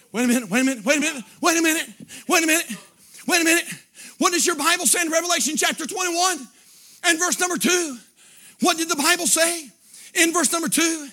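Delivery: full-force scripture preaching, punched phrase by phrase; impassioned preaching. Acted. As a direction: declaim the verses in a driving revival cadence.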